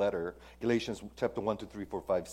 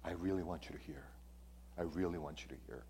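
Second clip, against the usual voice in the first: hushed plea; 6 semitones lower, 14 dB quieter, much faster than usual. Retold or imitated. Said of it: "Paul is basically saying".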